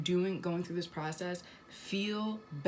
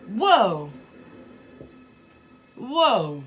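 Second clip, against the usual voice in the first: loud, higher burst of shock; startled whoa; 3 semitones higher, 11 dB louder than usual. Acted. Acting out a slap from the divine, startled exclamation. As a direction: exclaim as if just slapped. Acts being slapped by the divine.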